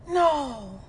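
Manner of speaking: stepfather's stunned no. Retold or imitated. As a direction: say it like a dazed old man. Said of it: "Just like this".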